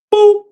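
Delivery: high loud boop; a sudden cartoon punch noise; mock punch sound.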